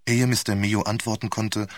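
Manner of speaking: ordinary delivery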